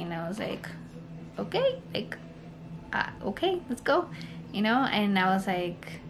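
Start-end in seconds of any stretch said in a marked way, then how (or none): 1.4-4.1 high, jittery self-quote; hyped-up nerves before surgery, voice jumping up; nervous okay. Retold or imitated. Mother retelling her nerves before the C-section, high and jittery.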